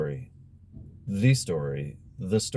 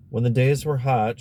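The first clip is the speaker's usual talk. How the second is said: ordinary delivery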